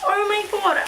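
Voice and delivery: real thrilled gasp of words; excited gush. Real, not acted. Gushing at the Build-A-Bear, thrilled and high.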